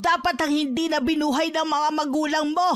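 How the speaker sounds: indignant mother telling off a bully; outraged scolding. Acted. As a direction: lash out in defence of your child. Mother explodes at bully's insult.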